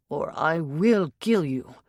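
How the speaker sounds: slow, menacing growled threat. A narrator's reading. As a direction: grind out the death threat slowly. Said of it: "Maxim growled".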